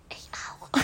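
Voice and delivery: whispered, shy little voice; timid whisper. Retold or imitated. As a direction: barely dare to say it. Quiet neighbour timidly replies. (schüchternes Flüstern)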